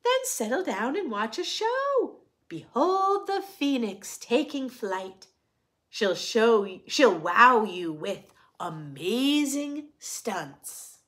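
lively, sing-song picture book reading; reading to children. A narrator's reading. Reading a rhyming picture book to children, sing-song. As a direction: read it brightly to the little ones.

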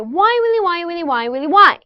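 repeated "Why, Willy" stepping down, last Why punched up; rhythmic tongue twister. Shown as a demonstration. Coach finishes the drill with students, rhythmic chant, punchy ending. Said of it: "이 리듬".

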